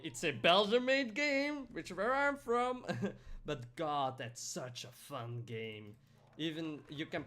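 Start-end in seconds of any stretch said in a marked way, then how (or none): 0.0-2.8 excited. Real, not acted. Proud about Belgian game.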